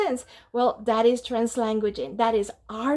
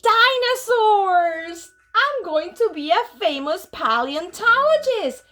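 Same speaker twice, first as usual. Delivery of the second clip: excited; 6 semitones higher, 5 dB louder, slower than usual. Acted. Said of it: "whispered Skippy John Jones".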